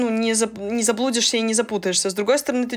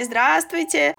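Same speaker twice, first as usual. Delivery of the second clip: sweet polite greeting; 5 semitones higher, much slower than usual. Retold or imitated. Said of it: "очень милым голосом говорили".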